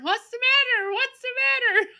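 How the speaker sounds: loud, high worried yell; hollering. Retold or imitated. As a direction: holler it up the hall. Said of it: "Daddy was in there hollering".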